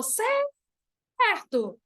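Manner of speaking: high, stretched call without much volume; mock calling out. Shown as a demonstration. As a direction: pretend to shout after a departing friend. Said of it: "eu me imagino gritando essa palavra".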